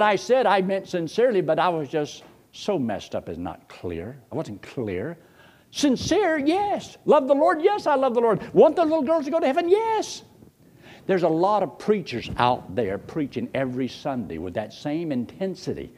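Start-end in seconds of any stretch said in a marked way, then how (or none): 5.7-10.2 high, loud, fast affirmations; emphatic high yeses. Acted. Preacher defending his sincerity, high and loud. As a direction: pitch each yes up high with fervor.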